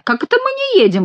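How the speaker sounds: high-pitched indignant question; indignant monk. A narrator's reading. Monk objects to being told they aren't moving, indignant. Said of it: "возмутился монах".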